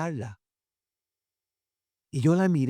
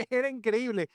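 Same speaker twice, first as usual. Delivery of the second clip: ordinary talk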